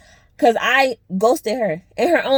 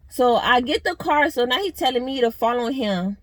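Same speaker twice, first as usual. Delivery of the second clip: ordinary talk, nothing marked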